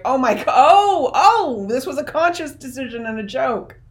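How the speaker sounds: a sudden delighted penny-drop; excited realization that fades into explaining; dawning realization. Retold or imitated. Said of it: "you're like".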